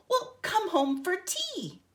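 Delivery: put-on high, bright little-boy voice; boy's eager invitation. Ben invites bear in story.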